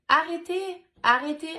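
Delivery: high, loud child's cry, imitated; high-pitched pleading shout, retold; daughter's little voice. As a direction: imitate a little girl crying out to make it stop. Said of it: "avec sa petite voix".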